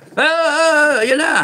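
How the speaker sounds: high mocking panicky voice. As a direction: ham up a flustered panic. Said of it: "hindi yung ganyan na". (kunwaring natataranta)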